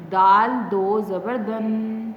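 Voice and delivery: slow spelling ending in a hummed دن; tanween reading demo. Shown as a demonstration. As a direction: stretch دال and دو, hold the n of دن. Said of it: "ہم اس طرح پڑھیں گے".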